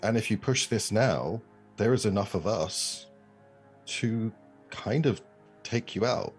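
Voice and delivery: normal talking voice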